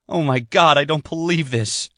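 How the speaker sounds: she realizes it's gone, panic rising; panicked disbelief. Acted. Sydney discovers the lost password, alarmed panicked cry.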